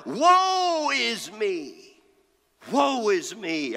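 drawn-out, high pulpit cry of woe. Acted. Preacher voicing Isaiah before God, crying out. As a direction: wail it out slowly, then let it fall. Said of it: "I cry".

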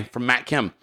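ordinary talk, nothing marked

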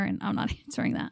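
quiet, breathy giggle through the words; half-laugh it under your breath; laughing half-whisper. Real, not acted. To her co-host about ignoring the call, laughing quietly.

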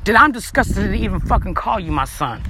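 lightly acted low parental scolding; disappointed parent. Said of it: "this is what I fucking tell him".

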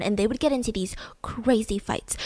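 hushed whisper on the fights. Real, not acted. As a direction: lean in and whisper it. Gossiping about a toxic relationship.

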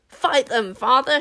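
put-on loud, urgent boy's shout. A narrator's reading. Son urging his father to fight, shouting. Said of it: "shouted Tsar".

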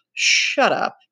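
breathy, exasperated dismissal; irritated. A narrator's reading. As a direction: shut them down with an irritated huff. Jack snaps at Piggy.

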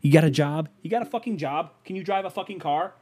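mocking comeback. Man imagines insulting the thirteen-year-old, high mocking jeer. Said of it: "just say things that he has no way".

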